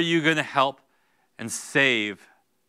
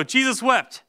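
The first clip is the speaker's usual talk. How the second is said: put-on higher, louder eager child's answer; kid answering; 10 semitones higher, 7 dB louder, slower than usual. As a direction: pipe it up like a proud kid. Pastor voices a kid's answer.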